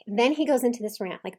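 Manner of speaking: ordinary talk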